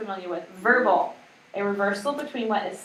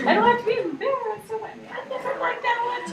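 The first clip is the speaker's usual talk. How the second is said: excited; 13 semitones higher than usual. Retold or imitated. Continuing Jim's voice.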